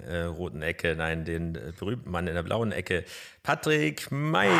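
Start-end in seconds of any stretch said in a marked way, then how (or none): 3.4-4.5 ring announcer. Acted. Introducing guest like ring announcer.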